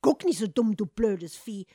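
loud angry scolding. Acted. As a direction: snap at the cat. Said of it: "schimpfte Hans mit dem Kater".

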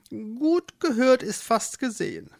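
high-pitched animal voice; mole character. Acted. Mole overhears desperate Eduard, high character voice. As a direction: pipe up as the eavesdropping mole.

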